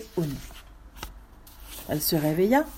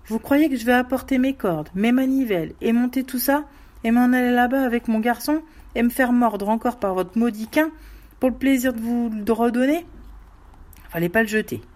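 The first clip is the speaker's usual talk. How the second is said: louder, higher, scolding grumble; irritated retort; 5 semitones higher, 4 dB louder than usual. Acted. Digger scolding the widow for throwing the dog, loud irritated grumble. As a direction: lecture her like a fool. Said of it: "Il répondit".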